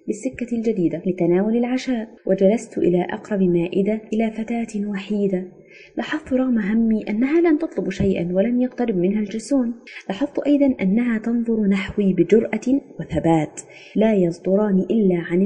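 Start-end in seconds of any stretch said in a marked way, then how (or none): none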